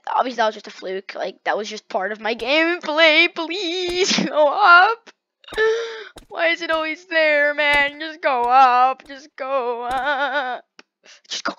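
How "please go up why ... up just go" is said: high, wailing, drawn-out begging; loud tearful whine at the game; desperate pleading. Real, not acted. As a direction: whine and stretch every plea.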